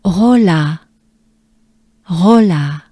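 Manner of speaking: Rola, repeated firmly with French R; teacher repeats Rola. Shown as a demonstration. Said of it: "Take a good breath".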